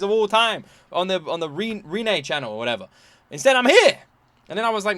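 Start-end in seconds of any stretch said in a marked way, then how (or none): 3.3-4.0 loud exasperation. Real, not acted. Frustrated rant.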